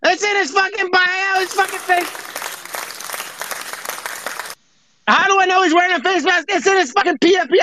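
high-pitched, indignant tirade; worked-up mock rant. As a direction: rant high, as if absurdly put upon. Mock outrage introducing [name].

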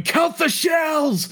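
high, drawn-out frantic yell; frantic cry. Acted. Joe shooting into the air, high drawn-out frantic yell. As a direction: howl it like losing control.

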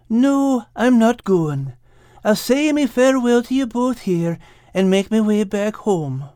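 high character voice, sinking at the end; bow out with mild sorrow; sad refusal. Acted. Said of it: "said Al sadly".

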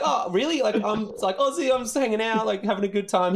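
very high, innocent plea; naive protest. Retold or imitated. Drunk Aussie tourist talking to bouncer, high-pitched protest. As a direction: imitate a harmless tourist pleading his case.

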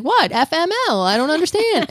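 high, puzzled disbelief; baffled. Retold or imitated. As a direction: play her totally confused. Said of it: "And she's like".

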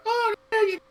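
very high squeaky alien voice; high squeaky grunt. Retold or imitated. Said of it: "how they'd be like".

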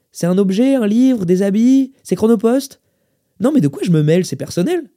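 high, loud list of guesses; exasperated quizzing. Acted. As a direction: fire off questions in exasperation. Exasperated manager quizzes the lady.

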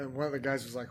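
ordinary delivery

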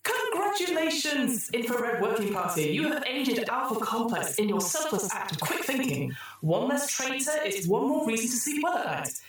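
high, bright AI announcer; the computer rewarding the troubleshooters, in a chirpy synthetic voice; cheery computer voice. Acted. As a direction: sing out the congratulations like a cheerful machine.

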